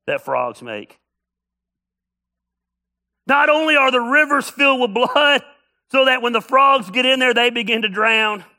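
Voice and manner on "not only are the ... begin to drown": louder, higher preaching. Acted. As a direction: press the point like a preacher. Preacher raises the intensity.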